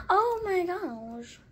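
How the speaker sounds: ordinary talk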